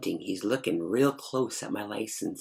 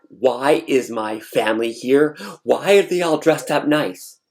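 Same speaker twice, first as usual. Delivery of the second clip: loud disbelief; 3 semitones higher, 7 dB louder than usual. Retold or imitated. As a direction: demand an answer in disbelief. Shocked out-loud question.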